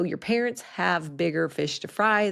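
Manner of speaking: usual speaking voice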